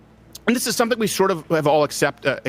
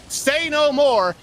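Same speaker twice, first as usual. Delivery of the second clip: eager reporter; 8 semitones higher, much slower than usual. Retold or imitated. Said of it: "And he said".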